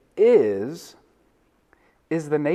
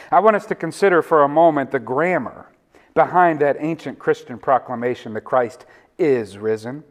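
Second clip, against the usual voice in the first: normal talking voice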